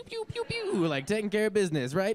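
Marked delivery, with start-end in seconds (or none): 0.0-0.9 very high laser pew sounds; laser noises. Pretend. IUD guy in space helmet shooting, high laser pews. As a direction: fire off squeaky laser blasts.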